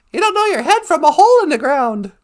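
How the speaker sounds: high, loud mock-grandpa scolding; hammed-up elder's saying; imitating grandpa. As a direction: quote an old-timer's put-down, loud and high. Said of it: "as my grandpa would have said".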